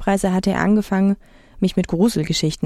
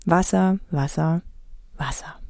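fading repetition; 4 dB quieter than usual. A narrator's reading. Narrator staring at endless sea, voice fading away. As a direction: let it die away word by word.

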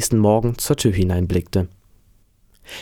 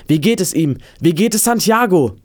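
high, raised calling voice; 8 semitones higher, 3 dB louder than usual. A narrator's reading. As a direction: call the question out, pitched well up. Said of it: "Er rief".

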